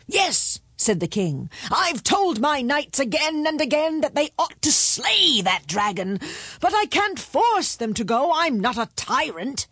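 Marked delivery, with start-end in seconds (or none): 0.1-0.6 high, quick royal agreement; high-pitched indignant king; exasperated king. A narrator's reading. 1.7-9.7 high, emphatic royal complaint; indignant high-pitched king; exasperated king. A narrator's reading.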